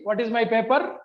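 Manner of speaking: ordinary talk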